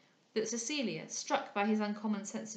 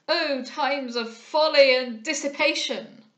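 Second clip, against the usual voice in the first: slow, high, booming denunciation; loud exclamation; 5 semitones higher, 12 dB louder, slower than usual. A narrator's reading. Old moralist denouncing society from a distance, loud and declamatory. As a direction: cry it out slowly, as if across a room. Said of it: "exclaimed a voice at some distance".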